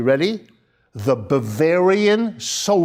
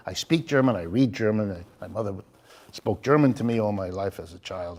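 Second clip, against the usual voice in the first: ordinary talk, nothing marked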